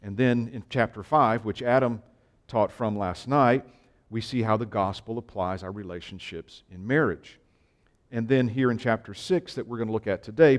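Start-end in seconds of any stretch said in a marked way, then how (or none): none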